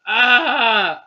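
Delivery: short loud mock scream of pain; bad fake scream. Reader forcing a pained scream. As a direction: try a scream and fail. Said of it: "I don't know how to scream".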